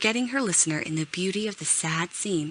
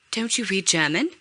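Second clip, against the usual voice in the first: ordinary delivery, nothing marked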